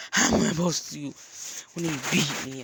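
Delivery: loud, distressed plea; upset protest. Acted. Beaten girl answering the advice of a stranger, distressed and loud. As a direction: throw the question back, hurt and loud.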